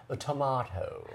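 "tomato" with a posh long AH; sophisticated tomato. Pretend. Host announces the page-turning sound, "tomato" said tuh-MAH-toe. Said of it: "you sound very sophisticated".

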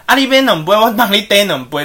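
loud retort from father to customer; deliberately loud. Retold or imitated. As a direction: announce it loudly to the whole shop. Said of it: "故意讲很大声给客人听".

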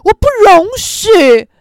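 loud, slow, high indignant declaration; hammed-up delinquent girl outburst; tough gangster girl. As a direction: play an affronted street-tough girl, dragging the words. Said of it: "我就是八加九小太妹".

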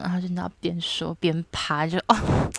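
ordinary talk, nothing marked

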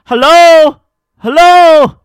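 very loud, high hello across the counter; loud shout. Retold or imitated. Demanding attention from the busy staff, loud high shout. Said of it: "我就在柜台超大声的".

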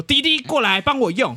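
high-pitched imitation of a pushy auntie; demanding auntie. Auntie at the copier calling the clerk over, demanding. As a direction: summon the clerk across the shop. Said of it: "有一个阿姨在影印机那边说".

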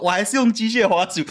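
normal talking voice

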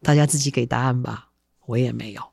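ordinary delivery, nothing marked

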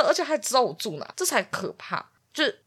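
usual speaking voice

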